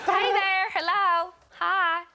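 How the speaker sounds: hammed-up American greeting down the phone; American accent. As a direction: play a cheery American operator answering a call. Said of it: "speak to an American".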